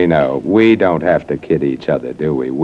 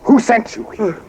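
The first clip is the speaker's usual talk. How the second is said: rising panic. Acted. Panics, demands answers.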